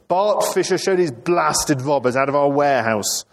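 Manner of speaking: high-pitched indignant command; acted outrage at normal volume; angry order. Angry deputy orders robbers out.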